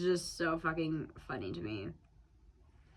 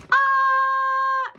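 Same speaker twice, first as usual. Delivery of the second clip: high, loud drawn-out "ah"; feminine shout; 11 dB louder than usual. Shown as a demonstration. Performing a note they wrote, high feminine shout. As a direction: shriek a long, high-pitched "ah". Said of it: "That's just so feminine".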